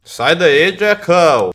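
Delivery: loud panicked yell; shouting. Real, not acted. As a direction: yell it out in alarm. Yelling at game enemy.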